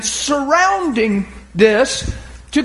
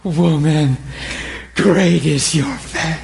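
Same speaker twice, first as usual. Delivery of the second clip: slow, lower and tender; tender praise; 8 semitones lower, slower than usual. Acted. Jesus praises the woman's faith, slow and tender. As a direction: tell her gently, moved. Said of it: "he goes".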